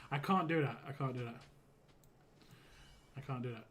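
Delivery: ordinary delivery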